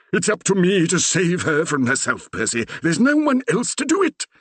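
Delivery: put-on high, loud earnest old vicar; determined Reverend. A narrator's reading. The Reverend telling Percy he must save his daughter, determined. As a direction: insist grandly on rescuing her.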